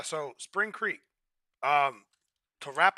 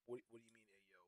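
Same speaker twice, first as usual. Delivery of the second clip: much softer, lower confused mumble; confused quiet; 5 semitones lower, 23 dB quieter, much faster than usual. Retold or imitated. Imitating himself confused by the slang, soft and low. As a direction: mutter it, puzzled. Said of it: "I'm like".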